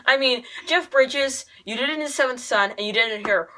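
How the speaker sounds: ordinary delivery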